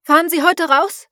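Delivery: high, loud call to the fisherman; shouting over wind. A narrator's reading. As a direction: keep yelling into the storm. (Frau ruft dem Fischer zu, laut gegen den Wind)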